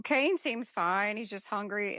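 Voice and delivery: ordinary talk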